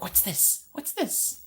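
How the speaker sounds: high, wondering character voice; high excited mock Jack; imitating Jack Skellington. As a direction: gasp at everything like Jack. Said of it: "he'd be like".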